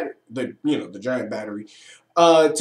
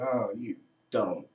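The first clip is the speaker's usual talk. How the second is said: much lower, much softer sneer; dismissive scoff; 7 semitones lower, 11 dB quieter than usual. Retold or imitated. Reviewer recalls dismissing the Joy villain, low scoffing. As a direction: mutter it like you're unimpressed. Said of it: "looked at the Lieutenant of Joy and went".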